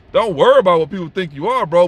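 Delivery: normal talking voice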